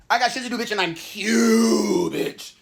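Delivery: high, loud, slow stretched cute; sassy brag. Real, not acted. Bragging to viewers, slow high sassy stretch.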